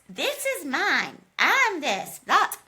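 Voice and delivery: high, slow bratty whine; whiny Viserys impression. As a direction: whine like a spoiled prince. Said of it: "I can't actually do any voices".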